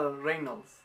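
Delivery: low, soft, hesitant attempt; unsure pronunciation. Real, not acted. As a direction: try the foreign name doubtfully, trailing away quietly. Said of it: "No sé si se pronuncia así".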